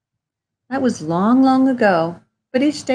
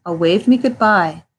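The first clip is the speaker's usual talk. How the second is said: ordinary delivery, nothing marked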